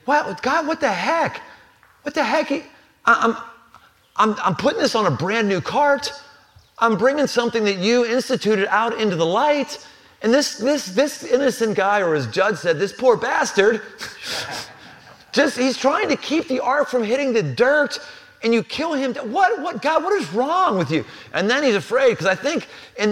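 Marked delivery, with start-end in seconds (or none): 0.1-12.0 high, indignant outcry at God; David protesting God, outraged and high; angry David. Retold or imitated. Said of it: "first he's angry". 12.8-21.0 high, indignant outcry at God; David protesting God, outraged and high; angry David. Retold or imitated. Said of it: "first he's angry".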